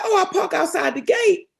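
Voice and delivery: high, chuckling, innocent answer; high giggly matter-of-fact reply; naive laughing reply. Retold or imitated. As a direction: say it as if it's obvious, laughing. Retelling her naive answer.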